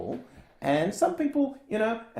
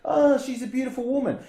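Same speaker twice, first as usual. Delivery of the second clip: simpering; 6 semitones higher than usual. Retold or imitated. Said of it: "simpered and sucked up to her".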